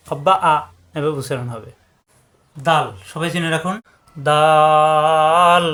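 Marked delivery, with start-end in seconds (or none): 4.2-5.6 দাল held very long on a level, louder note; long drawn-out vowel. Shown as a demonstration. Teacher demonstrates letter length, দাল intoned very long. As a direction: stretch the vowel of দাল for four alifs. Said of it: "চার আলিফ পরিমাণ টান হবে".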